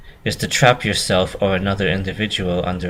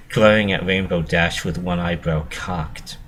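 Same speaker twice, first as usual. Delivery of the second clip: ordinary delivery, nothing marked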